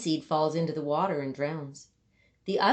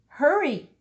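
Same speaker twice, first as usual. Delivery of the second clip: urgent cry; 7 semitones higher, 6 dB louder than usual. A narrator's reading. Narrator urging the slow seed.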